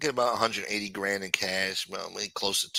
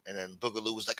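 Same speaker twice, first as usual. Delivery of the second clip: ordinary talk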